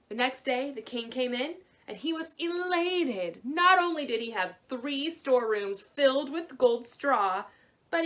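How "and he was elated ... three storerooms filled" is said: elated narration. A narrator's reading. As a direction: savour the king's joy. Narrator shows king's joy.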